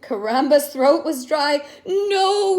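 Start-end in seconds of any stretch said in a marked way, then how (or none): none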